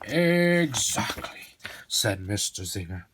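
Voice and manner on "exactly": slow knowing. Acted.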